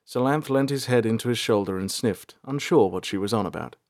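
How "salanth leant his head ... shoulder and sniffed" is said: plain reading voice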